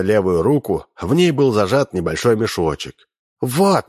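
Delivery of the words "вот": shout. A narrator's reading. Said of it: "крикнула она".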